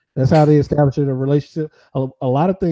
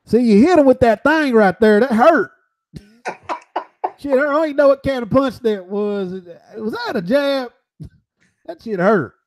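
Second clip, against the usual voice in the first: Butterbean voice; 8 semitones higher than usual. Retold or imitated. Still doing Butterbean voice.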